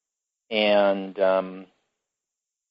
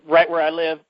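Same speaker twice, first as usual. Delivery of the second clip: getting choked up, real tears close; choking up; 6 semitones higher, 4 dB louder than usual. Real, not acted. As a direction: voice catching with emotion. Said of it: "Hang on, I'm choking up".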